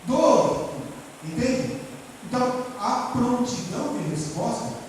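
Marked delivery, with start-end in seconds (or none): 0.1-0.7 "Um" gritado e agudo; comando gritado. Shown as a demonstration. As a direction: solte a contagem com força. Demonstra comando de contagem. (voz de comando, bem forte)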